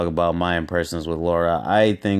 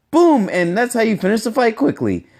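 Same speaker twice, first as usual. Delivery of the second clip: high-pitched, louder mimicked game-character voice; Laura; 5 dB louder than usual. Vlogger mimicking the trailer line to critique her accent, high put-on voice. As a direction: imitate a female fighter's trailer line in a raised, put-on voice.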